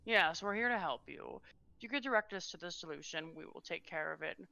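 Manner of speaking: calm and businesslike; smooth diplomat. Acted. Medius quietly assures the lady they will help.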